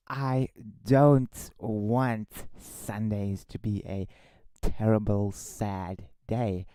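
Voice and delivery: slowed right down, each word deliberate; re-say it slowly and carefully; said properly. Shown as a demonstration. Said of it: "let me re-say that".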